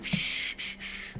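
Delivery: whispered, hushing shh; calm her down with a breathy hush; a hooded figure hushing a frantic woman, whispered; soft shushing. Acted.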